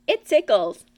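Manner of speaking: a loud squeal from Piglet; crying out. A narrator's reading. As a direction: squeal with a bee on your nose. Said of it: "cried Piglet".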